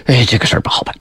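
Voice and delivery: soft whisper to himself, worried; whispered aside. Acted. The king alone after her fall, whispering in worry. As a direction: breathe it out under the breath, uneasy.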